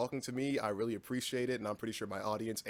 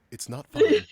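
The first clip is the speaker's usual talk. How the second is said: narrator voice; 14 semitones higher, 12 dB louder, slower than usual. Pretend.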